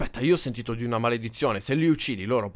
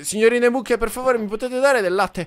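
higher, slower, sweetly polite request; mock polite plea; 8 semitones higher, 4 dB louder, slower than usual. Asking the cows for milk, sugary mock politeness. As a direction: ask the ladies ever so nicely.

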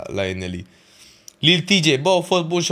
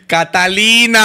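loud, higher shouted name; calling out; 7 semitones higher, 7 dB louder, slower than usual. Retold or imitated. Recreating the crowd calling the girl on stage, shouting. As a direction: call her across the crowd.

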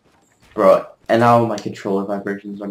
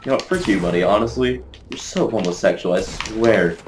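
ordinary talk

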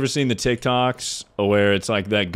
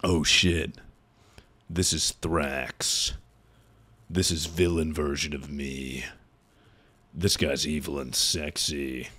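lower, slower, hushed mock-dramatic villain voice; mock villain persona; 7 semitones lower, 7 dB quieter than usual. Streamer mocking a claimed villain personality, low and hushed. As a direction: ham up a brooding evil alter ego. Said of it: "You're not like, like".

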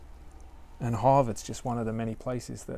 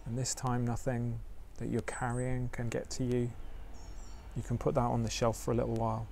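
guided meditation; 4 dB quieter than usual. Acted. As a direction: ease listeners into stillness gently.

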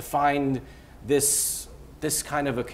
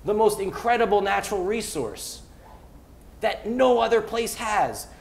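higher, rousing, impassioned pitch for the city; fired up; 6 semitones higher than usual. Real, not acted. Fired up by Mike, pitching Philly's young talent with real excitement. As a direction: let the passion carry you higher. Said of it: "you got me going, Mike".

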